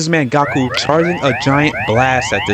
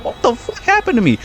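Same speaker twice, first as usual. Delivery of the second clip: much higher, strained disbelief as the stabbed guard; shocked guard; 12 semitones higher than usual. Retold or imitated. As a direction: squeak it out like a stunned guard who just got a hole punched in him. Shocked guard voiced after punch.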